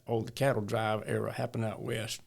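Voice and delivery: ordinary talk, nothing marked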